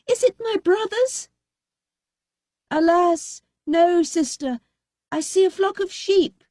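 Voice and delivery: high sing-song answer; sister Anne, lightly voiced. A narrator's reading. Anne answering from the tower. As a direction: report from the tower, lilting.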